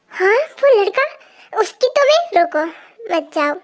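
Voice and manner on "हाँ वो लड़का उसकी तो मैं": acted indignation, pitched up; jealous outburst. Friend spots a boy near his crush, jealous outburst. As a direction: flare up at the sight of a rival.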